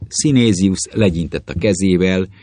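usual speaking voice

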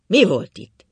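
loud, rough-toned question; rough gruff demand. A narrator's reading. Husband back in the bedroom asks his worried wife, rough and loud. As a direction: snap out a coarse, loud demand. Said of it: "azon a durva hangon".

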